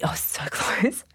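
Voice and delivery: slow whisper with a laugh; amused whisper of a near miss; whispered confession. Real, not acted. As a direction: whisper it slowly, half laughing. Confiding a near slip.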